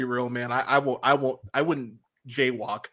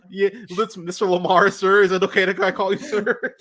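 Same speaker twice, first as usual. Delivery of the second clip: higher, laughing, overpolite voice; nervous deference; 7 semitones higher, 5 dB louder than usual. Pretend. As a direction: tiptoe around someone scary. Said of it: "I'm gonna be like".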